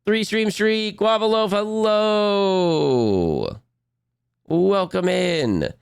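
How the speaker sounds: high cartoon host voice, drawn out; high character greeting. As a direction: greet chat in a squeaky cartoon voice.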